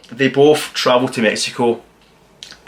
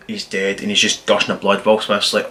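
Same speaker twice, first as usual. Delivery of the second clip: ordinary talk, nothing marked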